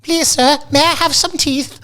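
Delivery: very high, small pleading voice; Oliver Twist plea. Retold or imitated. Mocking friend's broken teeth, pleading like Oliver. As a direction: beg like a starving orphan.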